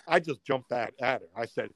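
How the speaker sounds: ordinary delivery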